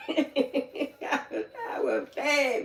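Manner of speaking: laughing through the words; giggling as she admits it; breaking into a laugh mid-line. Real, not acted. Laughing while retelling scolding the niece.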